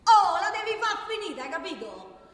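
loud, high, fed-up "Oh" and reproach; angry outburst. Acted. Worker fed up with her colleague, angry outburst. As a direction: snap at him to stop. Heated argument with coworker.

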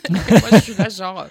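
loud laugh running into the words; amused, laughing lead-in. Real, not acted. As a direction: laugh as you set it up. Laughing while retelling meeting everyone.

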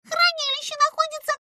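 fast high squeaky chatter; rattling off. A narrator's reading. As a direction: blurt it all out in a rush. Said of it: "затараторила Пимпочка".

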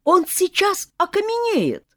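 high, loud, panicky cry; alarmed aunts. A narrator's reading. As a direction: beg the boy to stop in alarm. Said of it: "заволновались тётки".

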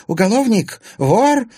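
high, loud accusing cries; shouting. A narrator's reading. Anfisa pointing at Timofey, shouting accusations. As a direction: hurl each word as an accusation. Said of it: "крикнула Анфиса".

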